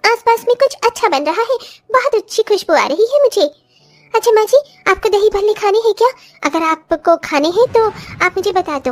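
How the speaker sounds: put-on high voice of a young daughter-in-law offering help. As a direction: lift the voice into an eager young bride.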